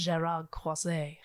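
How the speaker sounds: slow, drawn-out French pronunciation of "Gerard Croizet", said "zhay-rar krwa-zay"; French accent. Shown as a demonstration. Telling the friend the psychic's name in a mock French accent. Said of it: "say it in a French accent".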